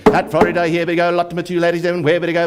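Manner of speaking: ordinary talk, nothing marked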